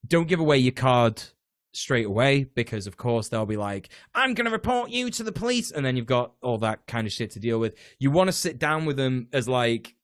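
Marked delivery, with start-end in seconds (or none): 4.1-5.7 high-pitched mimicked threat; mock indignant landlord. Landlord threatening union members with police, mock indignation. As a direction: put on a landlord's high, whiny protest.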